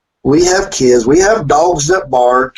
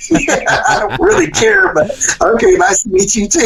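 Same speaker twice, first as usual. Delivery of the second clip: 1 laughing; 8 semitones higher than usual. Real, not acted. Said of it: "laughing aside".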